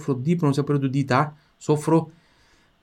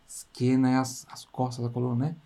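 usual speaking voice

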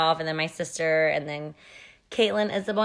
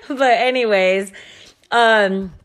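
high laugh then a louder laughing "anyways"; 3 semitones higher, 5 dB louder, slower than usual. Real, not acted. Wrapping up her lecture to her husband, laughing. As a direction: laugh it off and move on.

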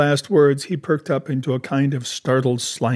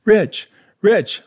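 higher, louder insistence; castaway repeats his claim hotly to Jim; 9 semitones higher, 5 dB louder than usual. Acted. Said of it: "repeated the statement hotly".

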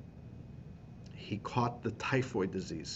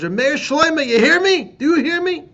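much higher, much louder calling out; screaming a name; 15 dB louder than usual. Retold or imitated. Friend screaming up at the quarantine window, loud calling. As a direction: yell up to the window. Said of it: "And he screamed".

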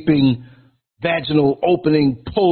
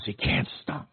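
breathy hush, no voice; whispered aside; 4 semitones lower, 11 dB quieter than usual. Real, not acted. Telling the crowd about busy parents in a whisper. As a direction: drop to a whisper, like a secret.

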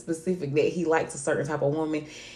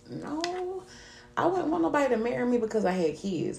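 retold objection; 7 semitones higher, 4 dB quieter than usual. Woman rejecting marriage just for the children, insistent objection. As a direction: object firmly in a raised pitch. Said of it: "And it's like".

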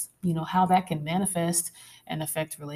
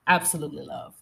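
normal talking voice

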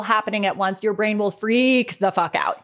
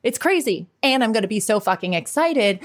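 ordinary delivery

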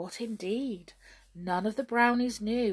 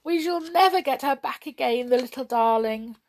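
high, plaintive lament; terribly distressed; 5 semitones higher, 6 dB louder than usual. A narrator's reading. Queen fearing Perenelle is gone, distressed. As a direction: lament it with raised, tearful voice. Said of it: "who was terribly distressed".

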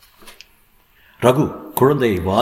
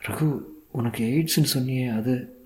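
soft, higher woman's voice; hesitant soft question; 4 semitones higher, 6 dB quieter than usual. A narrator's reading. Wife asking husband about his illness, soft hesitant question. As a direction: broach it softly and carefully.

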